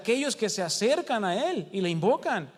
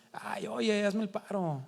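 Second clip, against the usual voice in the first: softer, coaxing servant begging casually; wheedling plea; 3 semitones higher, 5 dB quieter, slower than usual. Retold or imitated. Servant begging his king to forgive the debt, softly coaxing. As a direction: sweet-talk the king, half joking, quietly. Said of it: "¿Cómo tú crees que le suplicó".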